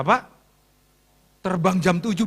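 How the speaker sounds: ordinary talk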